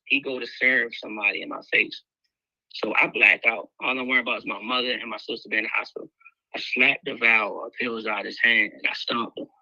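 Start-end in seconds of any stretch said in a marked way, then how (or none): none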